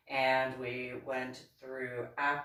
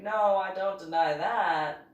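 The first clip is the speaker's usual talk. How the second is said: louder, higher, insistent pushback; retold protest; 6 semitones higher, 8 dB louder than usual. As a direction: push back firmly, raising pitch and volume. Retold argument, protesting back.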